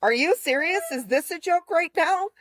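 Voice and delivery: high-pitched, disbelieving comeback; incredulous retort. Retold or imitated. Retelling her reply to his text about joking, incredulous.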